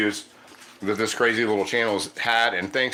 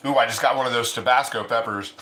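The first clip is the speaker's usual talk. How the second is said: ordinary talk, nothing marked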